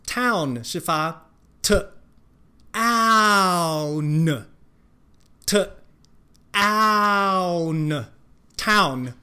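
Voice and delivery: town 拆成 t 和 own，非常慢地念; 一个部分一个部分地拆开念 town; slow broken-up town. Shown as a demonstration. (极慢拆音示范 town)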